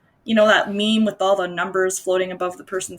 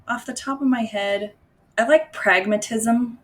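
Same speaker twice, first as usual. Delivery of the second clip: ordinary talk, nothing marked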